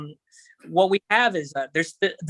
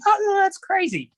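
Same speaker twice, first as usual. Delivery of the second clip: high squealing mock outrage; mock screaming owner; 14 semitones higher than usual. Imitating the dog owner's horrified high shriek. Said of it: "She starts screaming and yelling at me".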